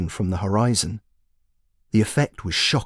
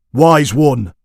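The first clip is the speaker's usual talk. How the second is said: loud, sharp bark, pitched up; 5 semitones higher, 9 dB louder than usual. Acted. Commander shouting at the dozing wizard, barked loud. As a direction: bark it to jolt him awake. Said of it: "the commander barked".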